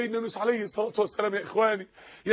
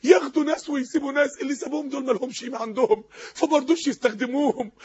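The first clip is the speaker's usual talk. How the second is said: usual speaking voice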